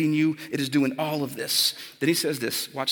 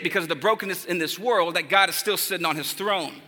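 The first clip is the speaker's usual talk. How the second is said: normal talking voice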